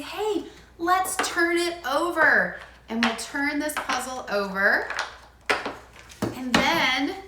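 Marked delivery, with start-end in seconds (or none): 0.0-2.6 higher, slow, bright sing-song; cheerful invitation. Shown as a demonstration. Parent inviting a toddler to flip the puzzle, slow cheerful call. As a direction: draw the child in, slowly. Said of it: "I say".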